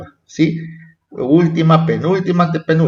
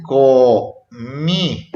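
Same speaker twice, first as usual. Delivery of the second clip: "comí" slowly, co... mí; split into syllables; 3 semitones lower than usual. Shown as a demonstration. Teacher explaining where the accent falls, "comí" syllable by syllable. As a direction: draw out both syllables of "comí". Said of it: "en sílabas".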